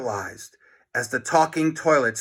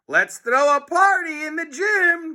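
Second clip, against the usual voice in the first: high excited shout; overjoyed teacher calling; 3 dB louder than usual. A narrator's reading. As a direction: cheer it out. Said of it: "shouted Mister Meaner".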